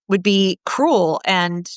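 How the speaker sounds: normal talking voice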